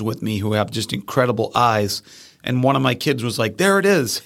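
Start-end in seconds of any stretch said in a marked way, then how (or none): 3.6-4.2 excited spotting. Retold or imitated. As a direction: imitate an excited student, much higher pitch. Student spotting the bird, retold.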